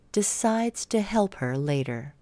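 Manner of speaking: ordinary delivery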